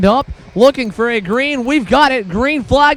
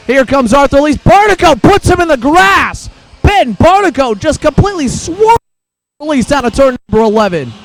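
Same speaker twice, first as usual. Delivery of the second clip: motorsport commentator; 2 semitones higher than usual. Real, not acted. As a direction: shout as he goes in the grass. Driver pushed into the grass.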